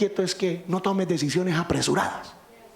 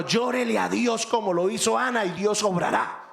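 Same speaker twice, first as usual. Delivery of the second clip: fiery preaching; 7 semitones higher than usual. Acted. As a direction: preach it hard, rising with fervor. Preaching at full force.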